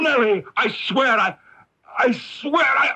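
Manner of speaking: husband choking mid-sentence, high strangled cry. Acted. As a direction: gasp it out as he collapses. Husband collapsing, choked cry.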